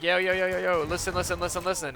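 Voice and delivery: high, repetitive, hurried plea; retold panicky attempt to calm them; urgent placating. Bystander to cops ready to go, urgent placating.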